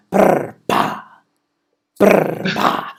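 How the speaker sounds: loud breathy lip-trill noise; mock politician noise; Malema imitation. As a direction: blow it out with a raspy buzz. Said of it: "I think Julius Malema".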